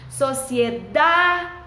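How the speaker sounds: sociedade with DA stretched long and loud; slow, syllable-stressed "sociedade", DA held. Shown as a demonstration. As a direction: draw out and punch the DA in sociedade. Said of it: "Quem é a sílaba mais forte? O da".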